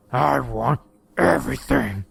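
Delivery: slow Texas drawl, creature voice; lazy menacing drawl, drawn out. A narrator's reading. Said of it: "he said in a slow drawl".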